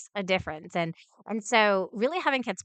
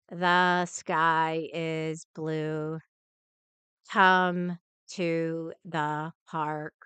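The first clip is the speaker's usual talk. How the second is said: slow flat robot monotone; 3 semitones lower, much slower than usual. Retold or imitated. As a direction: read it like a robot, no rise or fall. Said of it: "those robot talkers".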